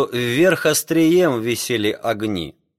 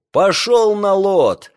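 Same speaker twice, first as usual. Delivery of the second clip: slow, high, loud order; shouting over wind; 9 semitones higher, 5 dB louder, slower than usual. Acted. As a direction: bellow it into the gale. Captain orders over the storm.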